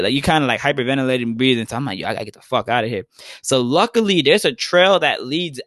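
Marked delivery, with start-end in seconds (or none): 2.0-3.0 rushed. Retold or imitated. Hyperventilating, rushing to leave.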